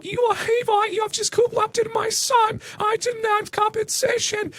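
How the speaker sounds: very high, slow falsetto Karen scream; hammed-up high-pitched furious mother. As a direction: shriek it up high like an outraged mother. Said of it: "she screamed".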